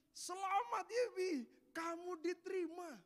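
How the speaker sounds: high, slow tearful congratulations; father choking up with happy news. Retold or imitated. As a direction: say it like a father crying with joy. Father tearfully tells son he passed.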